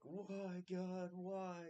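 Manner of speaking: very high, loud mock wail; hammed-up imitation of an anguished yell; Joey's wailing yell. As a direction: cry out to heaven in comic despair. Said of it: "Joey's like".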